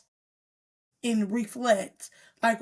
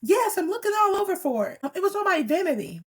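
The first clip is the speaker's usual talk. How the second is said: put-on high whiny kid answer; a child insisting she searched everywhere; stepdaughter voice; 9 semitones higher than usual. Stepdaughter answering Nisha impatiently, high whiny child voice. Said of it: "I'm like".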